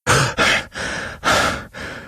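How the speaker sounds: long unvoiced sigh; breathy sigh. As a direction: let out drawn-out breaths. Game character in eerie manor.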